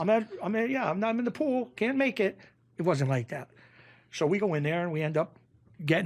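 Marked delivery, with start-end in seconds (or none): 0.0-2.4 higher whiny excuse-maker voice; light mock of a flimsy excuse. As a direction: parody a guy dodging the call in a higher voice. Mocking a lame excuse.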